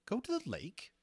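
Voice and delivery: high astonished question. A narrator's reading. Harcourt stares at Lawrence in astonishment, high incredulous question. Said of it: "stared at Lawrence in open astonishment".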